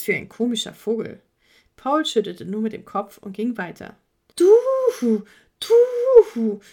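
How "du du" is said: squeaky high owl stammer, louder; a cheeky bird, high and loud; teasing owl. Acted. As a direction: needle the horse in a shrill owl voice. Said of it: "meinte der Vogel".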